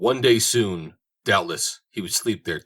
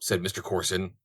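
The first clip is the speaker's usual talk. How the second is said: ordinary delivery, nothing marked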